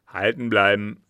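loud order to the driver; commanding. A narrator's reading. Passenger orders his coachman to wait, commanding. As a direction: give a firm, loud command. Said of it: "gebot er dem Kutscher".

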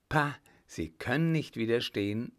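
ordinary delivery, nothing marked

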